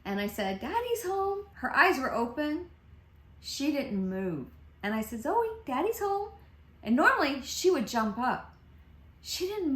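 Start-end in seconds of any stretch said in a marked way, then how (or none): 0.6-1.5 high singsong call to a pet; imitated happy call to a dog. Acting out calling to her dog. 5.2-6.3 high singsong call to a pet; imitated happy call to a dog. Acting out calling to her dog.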